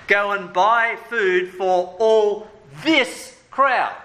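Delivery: slow, heavy read of the disciples' words; disciples' emphatic reply. Retold or imitated. Disciples answering Jesus, slow and emphatic. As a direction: drag it out, stressing each word. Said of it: "They answer".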